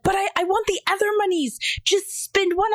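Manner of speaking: high-pitched mock pleading; imitated tearful child. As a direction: mimic an upset little kid begging. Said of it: "he's, like, crying and screaming".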